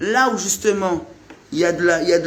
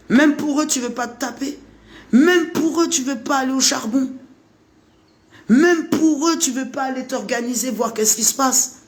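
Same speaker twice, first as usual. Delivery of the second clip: emphatic rant; 7 semitones higher than usual. Real, not acted. Insistent rant, repeated challenge.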